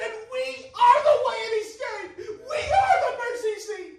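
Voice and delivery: high, loud, impassioned preacher's cry; pleading, preaching at the top of the voice; full-force preaching. Acted. Shouted preaching climax, laughing cries.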